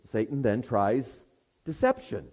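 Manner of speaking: usual speaking voice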